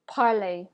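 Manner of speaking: "parler" as "par-lay", final R silent; French pronunciation. Shown as a demonstration. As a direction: model "parler" with its ending rhyming with play. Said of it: "pronounce this last example with me".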